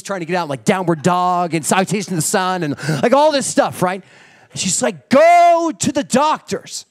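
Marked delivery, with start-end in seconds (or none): 5.1-6.8 loud, slow, stern command; firm order. Retold or imitated. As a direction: spell it out so he cannot refuse. Wife orders him to doctor.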